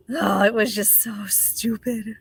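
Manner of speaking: breathy whisper of disgust. Real, not acted. Whispering to viewers about the stupid devotional. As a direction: drop to a whisper and scoff.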